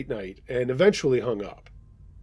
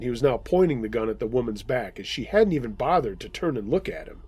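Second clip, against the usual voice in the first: ordinary delivery, nothing marked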